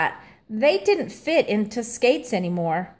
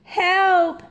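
cry for help; 9 semitones higher than usual. A narrator's reading. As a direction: call out in alarm. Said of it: "cried Stanley".

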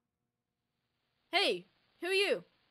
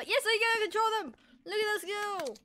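excited; 7 semitones higher than usual. Real, not acted. Gamer thrilled to control the dogs, excited. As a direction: cheer as the dogs start running.

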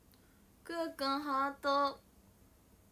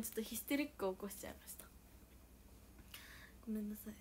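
whispered apology; 3 semitones lower, 6 dB quieter, faster than usual. Real, not acted. Apologizing after the rant.

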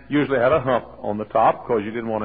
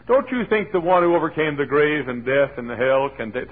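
usual speaking voice

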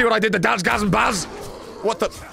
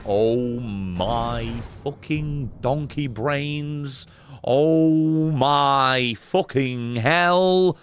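slow, dragged-out groan of defeat; drawn-out despair; 4 semitones lower, much slower than usual. Real, not acted. Streamer groaning at his useless game character, slow and dragged out. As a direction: stretch every word out in despair.

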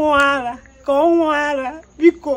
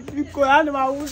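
usual speaking voice

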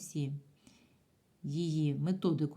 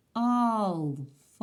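АЛФАВІТ drawn out syllable by syllable; calling out syllables; 3 semitones higher, 4 dB louder, much slower than usual. Shown as a demonstration. Teacher calls «Алфавіт» to count syllables, slow syllable-by-syllable call. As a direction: call out «Алфавіт» slowly, stretching each syllable. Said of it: "І слово гукаємо".

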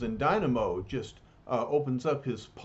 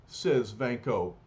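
ordinary delivery

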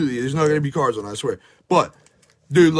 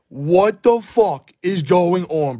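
ordinary delivery

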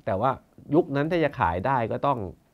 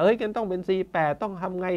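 mock scheming official, pitched higher; forestry official; 8 semitones higher than usual. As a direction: imitate a status-hungry bureaucrat. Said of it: "พวกนี้จะคิดอย่างนี้เก่ง". (ล้อเลียนเจ้าหน้าที่ป่าไม้ เสียงสูงขึ้น)

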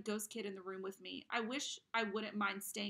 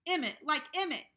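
loud, higher retold shout; Pop shouting; 7 semitones higher, 11 dB louder than usual. Father shouting about the murder of Emmett. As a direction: rage about it like an outraged father. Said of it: "I remember Pop shouting".

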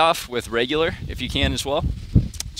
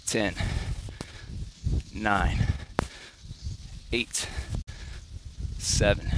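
quiet, strained counting mid push-up; counting workout reps; 3 semitones lower, 4 dB quieter, much slower than usual. Real, not acted. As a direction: count reps under your breath while working. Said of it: "I'm gonna count it out for you too".

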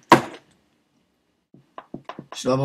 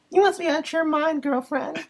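put-on high girly voice; 14 semitones higher, slower than usual. Prank caller's high voice.

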